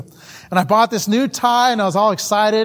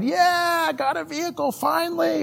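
excited; 9 semitones higher, 5 dB quieter than usual. Retold or imitated. Missionary recalling his first vehicle in Bolivia, excited. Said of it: "I was all excited".

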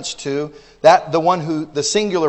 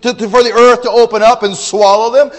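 loud, high preaching; fiery preaching; 7 semitones higher, 8 dB louder than usual. Acted. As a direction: drive it home from the pulpit. Preaching at full force.

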